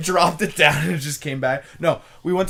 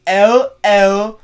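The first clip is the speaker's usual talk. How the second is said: high, loud, very slow put-on voice; imitating the guy; 6 semitones higher, 11 dB louder than usual. The stranger naming his channel, very slow. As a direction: stretch the name out high and loud. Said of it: "And he goes".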